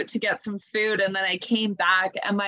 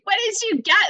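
high, louder mock call; booming mom voice; 11 semitones higher, 4 dB louder, faster than usual. Mom startling daughter again through doorbell, mock boom. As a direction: replay mom's startling boom. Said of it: "bombarded with this voice".

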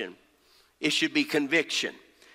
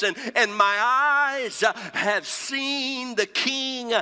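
high, drawn-out phrases falling at the close; emphatic preaching; 5 semitones higher, slower than usual. Real, not acted. Preacher reading Isaiah's confession to the congregation, emphatic preaching. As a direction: declaim it with weighty pauses. Preaches scripture and key point.

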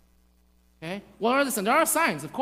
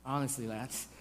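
low, soft, sincere goodbye; quiet, regretful affection; tender farewell; 9 semitones lower, 9 dB quieter, faster than usual. Acted. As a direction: say it gently, like a last goodbye. Imagined tender farewell to congregation.

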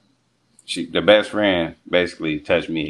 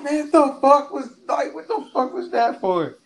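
high falsetto bewilderment; comically wounded, squeaked demand for a reason; stumbling, very high-pitched disbelief; shocked protest. Retold or imitated. Retold shock after being slapped.

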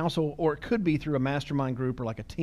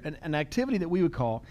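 usual speaking voice

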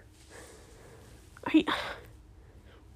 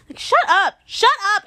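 high, loud snapping back; worked-up telling off; exasperated; 7 semitones higher, 6 dB louder than usual. Pretend. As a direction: cut the banker off sharply. Talking back to the banker.

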